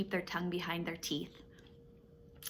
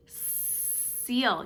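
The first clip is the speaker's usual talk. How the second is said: ordinary delivery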